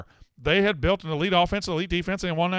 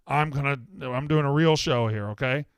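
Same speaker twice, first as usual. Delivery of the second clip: normal talking voice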